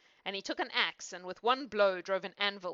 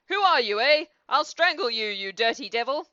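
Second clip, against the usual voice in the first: loud, raised dead-man roar; angry acted bellow; 4 semitones higher, 9 dB louder than usual. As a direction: roar it out as a threat. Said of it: "suddenly sat up and roared out".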